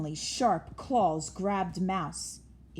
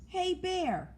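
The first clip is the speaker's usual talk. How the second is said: high call across the field; 11 semitones higher than usual. A narrator's reading. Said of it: "called Mouse".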